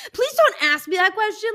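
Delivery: high, loud pleading whine. Retold or imitated. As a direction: beg her not to ask, whining. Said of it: "And I was like".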